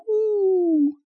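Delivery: very high, thin little "whoo"; teeny tiny hoot. A narrator's reading. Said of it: "came a teeny tiny voice from the forest".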